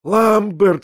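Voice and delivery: a high cry of a name as it dawns on him; sudden realisation. A narrator's reading. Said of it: "вскочил я вдруг, догадавшись".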